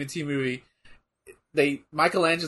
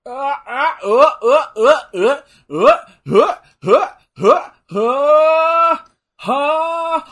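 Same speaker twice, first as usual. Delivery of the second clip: loud rising grunts, growing longer and higher; mock power-up grunts; 8 semitones higher, 8 dB louder, much slower than usual. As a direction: grunt repeatedly, building to a long high cry. Imitated grunts before mock battle cry.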